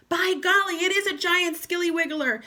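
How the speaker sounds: mock alarm. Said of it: "Mister Twit said".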